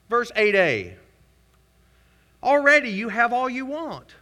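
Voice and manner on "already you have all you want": much higher, louder mock praise; sarcastic. Paul mocking the Corinthians' fullness, high sarcastic. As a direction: lay the sarcasm on thick. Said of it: "Y'all hear the sarcasm".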